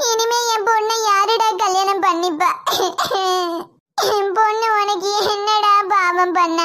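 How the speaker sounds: tearful plea, voice breaking; crying lament. Acted. Mother cries at the groom who ruined her daughter. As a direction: weep as you accuse him.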